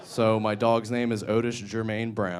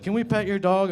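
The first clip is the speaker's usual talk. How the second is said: put-on squeaky little kids asking, pitched way up; high kid voice; 10 semitones higher, 4 dB louder than usual. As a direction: play the bus kids, high and eager.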